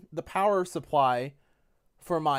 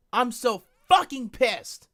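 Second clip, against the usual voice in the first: slow, louder, boiling over; louder seething repeat; 5 semitones higher, 4 dB louder, slower than usual. Real, not acted. Streamer telling his chat he's pissed, louder. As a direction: spit each word out, fuming.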